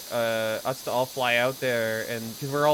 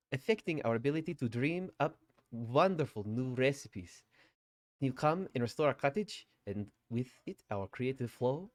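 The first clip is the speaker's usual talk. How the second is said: put-on German accent through laughter. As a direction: crack up mid-line but keep the German accent. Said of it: "a really terrible German accent".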